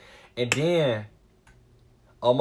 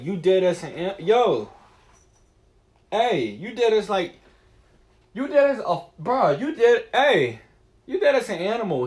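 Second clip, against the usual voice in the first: calling out to the cat; 5 semitones higher, 8 dB louder than usual. Acted. Talking loudly to his cat.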